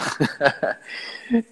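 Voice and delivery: short breathy laugh at his own joke; a brief real laugh after a playful line. Real, not acted.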